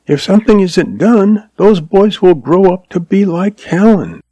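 high, earnest, worried woman's voice; anxious frontier woman. Acted. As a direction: plead it as a worried older sister, pitched high. Voices Lois, the anxious sister.

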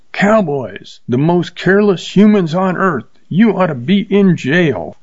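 high-pitched scolding scream; outraged woman screaming at a cowboy. A narrator's reading. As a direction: shriek the accusation at the careless cowboy. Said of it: "She looked at him and screamed".

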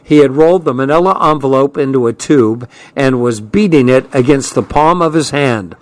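plain reading voice

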